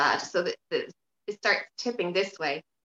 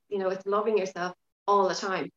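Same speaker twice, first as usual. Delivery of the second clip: normal talking voice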